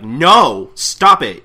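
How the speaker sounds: loud, high protest; angry announcer. Acted. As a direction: shout them down as the announcer. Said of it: "the announcer's getting angry".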